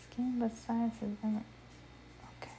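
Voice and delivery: rereads "besides his inner" under his breath; unvoiced mumble, checking the text; whisper. Real, not acted. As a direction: whispers the line to himself. Rereading a line to himself.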